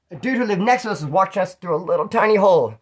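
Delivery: usual speaking voice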